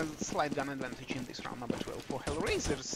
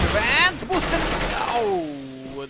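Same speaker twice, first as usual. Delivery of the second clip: excited call; 7 semitones higher, 8 dB louder, much slower than usual. Real, not acted. Caster calling out a play.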